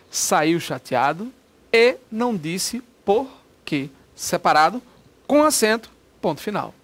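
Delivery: slow, word by word; dictation pace. Shown as a demonstration. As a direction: dictate it slowly. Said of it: "escreve a frase no papel". (ditado bem devagar)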